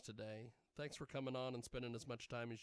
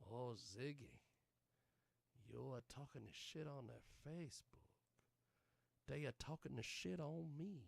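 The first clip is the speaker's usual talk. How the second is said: a hint of a put-on voice, softer; lightly imitated Johnny; 7 dB quieter than usual. Host quoting what Johnny always says, lightly imitated. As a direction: lightly mimic a regular's stock complaint. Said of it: "is what Johnny always says".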